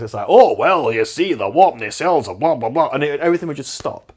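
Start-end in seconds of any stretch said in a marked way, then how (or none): 0.3-2.9 mocking Scotty's Scottish accent, louder; Scottish accent hammed up as a parody. Podcaster mocking Scotty's clunky lines, in a loud mock Scottish accent. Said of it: "delivered in this accent".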